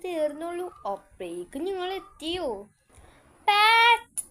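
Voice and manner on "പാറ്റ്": loud high call across the yard. Acted. Friend calling Pat from outside, loud high call. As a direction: shout the name out to reach her.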